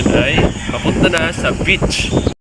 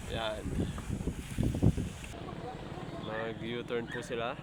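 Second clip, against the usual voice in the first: hushed voice, hiding so they won't hear; whispering; 3 semitones higher, 17 dB quieter than usual. Real, not acted. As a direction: keep it to a secret whisper. Hiding in back, talking secretly. (pabulong na boses)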